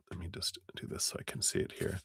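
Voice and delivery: fast, soft, unvoiced mutter to herself; hushed aside to herself; whispered aside. Real, not acted. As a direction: mutter it under the breath quickly. Muttering aside while adjusting.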